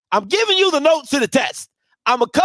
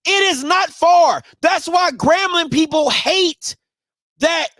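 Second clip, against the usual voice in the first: raised, heated rant voice; 5 semitones higher than usual. Real, not acted. Heated rant about coach.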